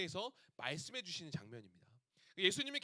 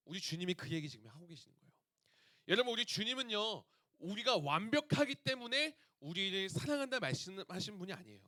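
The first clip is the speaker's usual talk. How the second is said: ordinary talk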